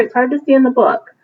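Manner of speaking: ordinary delivery, nothing marked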